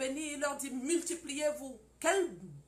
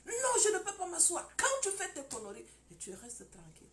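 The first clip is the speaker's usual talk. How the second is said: higher and louder refusal; angry husband; 7 semitones higher, 3 dB louder than usual. Acted. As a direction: snap back at her, higher. (mari en colère qui refuse de s'asseoir, plus fort)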